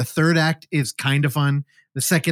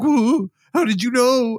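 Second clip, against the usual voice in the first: higher, slow imitation of crying; mock crying; 8 semitones higher than usual. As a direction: wail it like a pretend sobber. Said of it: "the dude's crying".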